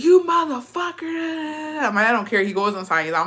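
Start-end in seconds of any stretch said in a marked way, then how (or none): 0.0-1.9 drawn-out, high imitation of an enraged mom; mock furious wail. Mom gets up after being hit, imitated furious high wail. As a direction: screech it slowly as a retold curse, high and stretched. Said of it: "and she's like".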